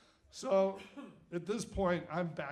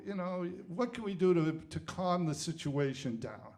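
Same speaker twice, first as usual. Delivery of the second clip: put-on New England accent. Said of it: "put on my best New England accent".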